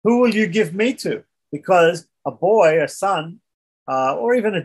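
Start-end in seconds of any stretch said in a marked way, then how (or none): 0.0-1.2 raised pitch, mock-innocent question; sarcastic boy questioning his father. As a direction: ask it pointedly, higher than usual. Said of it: "sarcastically, the boy says to his father".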